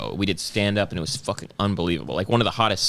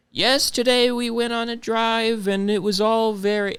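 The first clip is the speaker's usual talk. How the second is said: imitating great-grandmother; 12 semitones higher than usual. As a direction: put on a sweet old grandmother voice. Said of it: "she was like".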